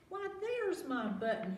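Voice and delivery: high excited cry; cried out. A narrator's reading. Bear finding his lost button, cries out happily. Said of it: "he cried".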